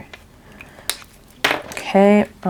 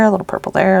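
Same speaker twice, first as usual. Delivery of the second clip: ordinary delivery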